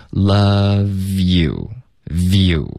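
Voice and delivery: "love you" slowly joined as luh-view; slow linking demo of "love you"; linked V and you. Shown as a demonstration. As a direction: draw out "love you" so V glides into you. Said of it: "我们要连发".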